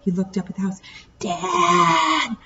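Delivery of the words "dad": high raw wailing call; boy wailing for his father; frantic wail. A narrator's reading. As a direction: wail for help, scared. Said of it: "Burke's frantic wail".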